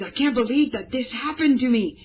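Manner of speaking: loud, high rush of disbelief; really excited. Shown as a demonstration. Teacher demonstrating an excited line for storytellers. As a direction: let the excitement spill out. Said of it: "If you get really excited".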